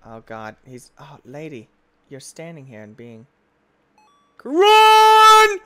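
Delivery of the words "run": high, loud shouts to flee; panicked screaming. Real, not acted. Gamer screaming to run from the monster, high and loud.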